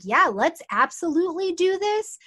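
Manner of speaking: laid-back, happy agreement; slowed-down eager. Retold or imitated. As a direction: still keen but relaxed. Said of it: "he's slowed down a little bit".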